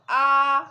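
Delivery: आ called out loud and long, open a; loud letter sound. Shown as a demonstration. Teacher to young kids, showing the letter sound: loud, open आ. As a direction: belt out आ as a clear open vowel for kids. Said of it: "अलिफ मद्दा का sound कैसे निकलता है?".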